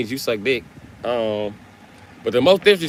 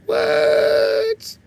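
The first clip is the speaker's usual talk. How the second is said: shocked shout. Pretend. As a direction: yell it in total disbelief. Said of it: "you was like".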